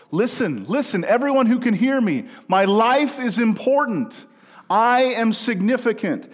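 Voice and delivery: loud, high proclamation to the crowd; crying out. Acted. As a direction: call out like a herald to everyone. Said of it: "He cried it out".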